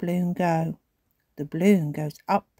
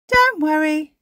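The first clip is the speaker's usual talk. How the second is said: higher, gentle grandma voice; reassuring granny; 10 semitones higher, 8 dB louder than usual. A narrator's reading. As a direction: soothe the children. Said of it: "says Granny Pig".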